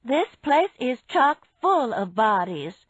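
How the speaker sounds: calling out. A narrator's reading. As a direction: call it out to the next room. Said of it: "she called from the kitchen".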